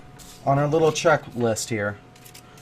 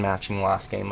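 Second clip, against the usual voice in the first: ordinary delivery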